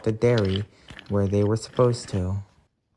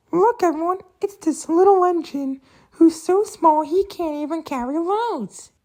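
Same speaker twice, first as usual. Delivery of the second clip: squeaky, much higher sneering taunt; mocking bully engine. Wild logging engine mocks the small engine, high squeaky taunt. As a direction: jeer at the little engine in a tiny high voice.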